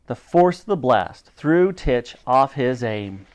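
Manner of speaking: usual speaking voice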